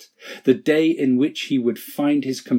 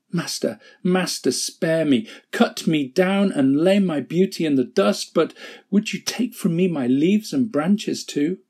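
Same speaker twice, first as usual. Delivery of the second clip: pleading; 6 semitones higher than usual. Acted. Bamboo begs to keep his leaves.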